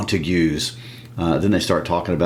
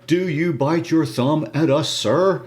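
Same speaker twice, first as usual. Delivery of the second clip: higher insistent repeat; 9 semitones higher than usual. Acted. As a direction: ask "Do you bite your thumb at us, sir?" again, much higher and pressing. Same line repeated, pitched up.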